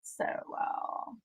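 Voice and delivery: soft voiceless whisper; whispered echo. Real, not acted. Streamer echoing herself to chat, whispered. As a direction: breathe it out quietly.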